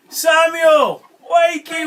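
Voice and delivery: high, loud storybook call. A narrator's reading. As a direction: call loudly to rouse a sleeper. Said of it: "God called one night".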